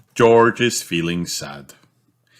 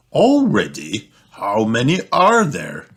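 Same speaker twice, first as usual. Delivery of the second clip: grumbling; 4 semitones higher, 3 dB louder than usual. Acted. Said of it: "grumbles Daddy Pig".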